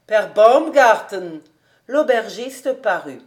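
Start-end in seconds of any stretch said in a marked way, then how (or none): 0.1-1.4 very loud, slow summons; loud drawn-out call for the landlord. A narrator's reading. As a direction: call the innkeeper from across the garden. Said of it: "criait le professeur Speck".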